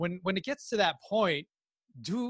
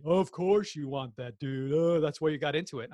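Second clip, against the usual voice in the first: mocking team; 4 dB louder than usual. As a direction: imitate teammates teasing, stretch the opening words. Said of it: "who laughs at me".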